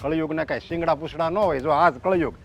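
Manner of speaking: low, soft, heavy-hearted wail to God; despairing lament to God. Acted. Father, hurt that his son defends the wife, lamenting quietly to God. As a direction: groan it out as if the world is ending.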